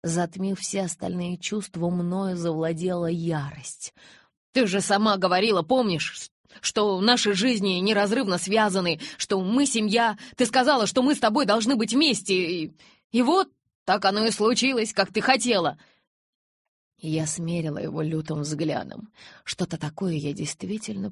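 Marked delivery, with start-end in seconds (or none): 4.5-15.8 loud, high-pitched, building urgency; desperate pleading. A narrator's reading. Jacob reminds Bella of her own words, desperate pleading. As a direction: press your case as a heartfelt plea.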